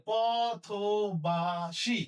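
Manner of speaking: পথবাসী drawn out slowly with a rise; slow demo. Shown as a demonstration. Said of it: "তালব্য স মতো উচ্চারণ".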